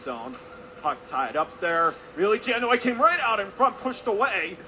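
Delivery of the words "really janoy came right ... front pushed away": higher, louder burst of urgency; lifted, punchy sports call; excited play-by-play. Real, not acted. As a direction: call a sudden scoring threat. Puck out in front of goal.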